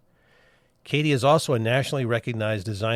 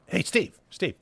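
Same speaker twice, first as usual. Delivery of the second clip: calling out; 8 semitones higher than usual. Real, not acted. Calling to cohost mid-sentence.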